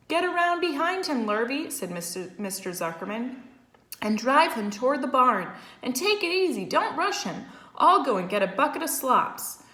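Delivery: high, loud farmhand orders; calling out orders. A narrator's reading. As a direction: call across the yard. Farmer directs hired man.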